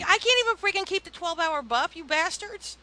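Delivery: high-pitched griping at the game makers; mock indignation. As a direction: protest the unfairness, voice pushed up. Mock rant at the game's makers.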